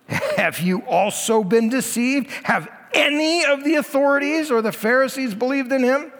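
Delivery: mocking, high scornful question. Pharisees mock the deceived officers, scornful and high. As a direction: sneer at them as fools.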